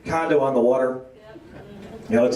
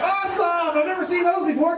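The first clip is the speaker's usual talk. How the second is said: high, gushing lady; mock-delighted tourist lady; gushing awesome; 13 semitones higher than usual. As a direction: coo it like a thrilled tourist. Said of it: "She's like".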